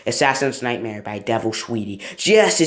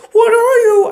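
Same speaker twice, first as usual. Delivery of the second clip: screaming; 10 dB louder than usual. Acted. Character screams line.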